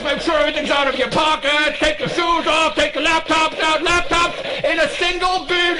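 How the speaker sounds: high, rapid-fire checkpoint orders; TSA agent barking. Retold or imitated. TSA agent ordering the line, high mock bark. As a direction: rattle it off like a bored screener.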